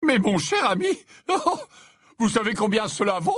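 high, loud, laughing astonishment; amazed exclamation. A narrator's reading. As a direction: splutter with delighted disbelief. Slughorn exclaims at the hair's value.

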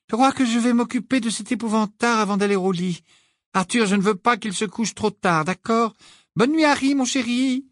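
high, scolding mother voice; motherly voice. A narrator's reading. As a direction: nag gently like a mum. Mrs Weasley voix de personnage. (mère qui gronde, voix aiguë)

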